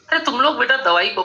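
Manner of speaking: ordinary talk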